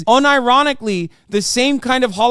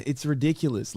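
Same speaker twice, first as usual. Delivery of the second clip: ordinary talk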